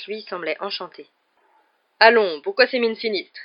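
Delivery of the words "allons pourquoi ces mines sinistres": high, loud beaming call; cheerful exclamation. A narrator's reading. Lockhart greets the gloomy class, loud and beaming. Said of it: "s'écria-t-il".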